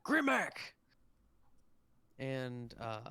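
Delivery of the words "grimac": high, loud captain's call; much higher, louder holler; shouting for someone. Acted. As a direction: shout the name across the ship to summon them. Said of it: "he turns and shouts".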